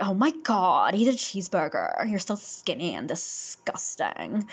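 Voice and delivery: exaggerated Valley Girl with swooping pitch; Valley Girl accent. Shown as a demonstration. Showing how the character would talk in English, Valley Girl accent. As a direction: drag out the disgust like a Valley Girl. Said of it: "his accent would be like a Valley Girl".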